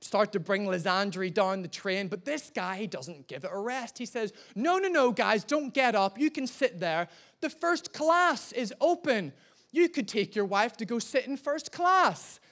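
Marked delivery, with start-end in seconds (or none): 4.5-12.4 high-pitched mock helpful passenger; meddling passenger. Meddling stranger tells couple about first class, mock helpful. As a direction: imitate an interfering passenger, pitched up.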